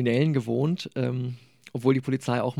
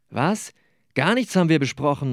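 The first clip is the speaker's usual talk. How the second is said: rufend; 4 semitones higher, 6 dB louder than usual. Acted. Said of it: "rief ich".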